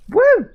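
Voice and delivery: loud, high whoop of joy; whooping cheer. Real, not acted. Gamer whooping while zipping on the grappling hook, loud and high. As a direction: let out a big high woo.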